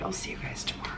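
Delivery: whispering. Real, not acted. Saying goodnight before bed.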